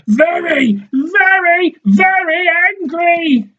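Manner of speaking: mocking high echo. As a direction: mimic his anger in a squeaky taunt. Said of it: "she says".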